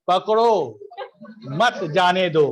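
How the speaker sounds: पकड़ो on its own, clear break, then मत जाने दो; pause after पकड़ो. Shown as a demonstration. Teacher showing the intended shout to the brother, pause after पकड़ो. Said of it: "ये बोलना चाह रहा था".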